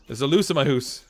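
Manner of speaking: mock-Canadian joke line, rounded vowels; Canadian accent. Streamer showing words that give him away, hammed-up Canadian accent. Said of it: "still certain words, like, out and about".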